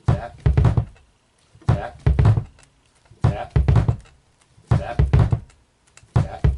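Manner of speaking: sharp loud cue on every tap; counting taps like a coach marking the beat; loud counted taps. Shown as a demonstration. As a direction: call each tap out loud over the playing. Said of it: "So it's just the tap".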